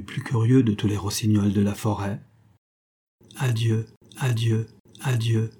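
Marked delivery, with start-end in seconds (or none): 3.4-5.5 adieu repeated without feeling, mechanical; sans inflexion. Acted. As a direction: say each adieu like a bird's call, empty. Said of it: "sans que l'âme communiquât une seule inflexion". (adieu répété sans aucune inflexion)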